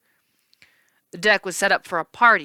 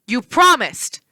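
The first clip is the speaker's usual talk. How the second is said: indignant; 6 semitones higher, 7 dB louder, slower than usual. Acted. Hero protests betrayal.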